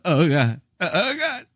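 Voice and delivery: high-pitched fake sob; mock weeping. Dad moved by anything about parenting, hammed-up tearful wail. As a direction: act out breaking down in tears. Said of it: "things like that, I'm like".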